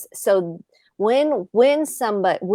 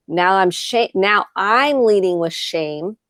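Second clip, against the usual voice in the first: ordinary delivery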